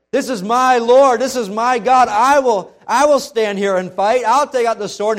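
loud, high battle cry of loyalty; high, loud, fiercely loyal cry; defiant shout. Acted. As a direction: shout it like drawing a sword. Preacher acts Peter drawing sword.

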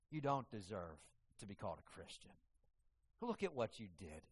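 soft, low insinuating voice of Satan; hushed accusing lie. Acted. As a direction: whisper doubt into the listener. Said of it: "Satan ... speaks into it. Lies".

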